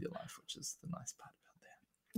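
breathy whispered aside; whispering. Real, not acted. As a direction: drop to a whisper. Aside after blocking troll.

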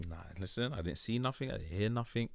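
soft, hushed see-nothing denial; quiet mock denial. Playfully denying seeing anything.

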